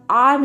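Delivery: आर्म with the र sounded; r pronounced. Shown as a demonstration. Teacher warning students off आर्म, r wrongly voiced. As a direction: say आर्म with an audible r before the m. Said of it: "student इसको गलत pronounce करते हैं".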